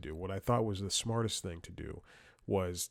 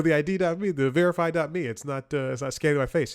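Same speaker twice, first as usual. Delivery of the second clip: raised, high-pitched phone explanation; louder, higher, insistent retelling; retold insistent complaint; 6 semitones higher, 7 dB louder than usual. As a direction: act out spelling it out loudly for someone. Retelling exasperated phone call to helpline.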